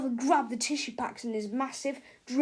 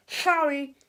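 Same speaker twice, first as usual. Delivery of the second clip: put-on nasal boy voice; 4 semitones higher, 7 dB louder than usual. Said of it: "said Melvin".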